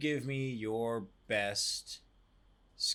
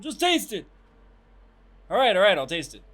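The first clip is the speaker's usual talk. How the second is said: high, loud comic yelling; hammed-up squealing movie quote; Coming to America impression; 10 semitones higher, 13 dB louder than usual. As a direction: shout it like an exasperated movie character. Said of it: "have you seen Coming to America?".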